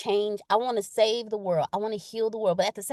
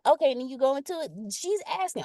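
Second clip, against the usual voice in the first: ordinary talk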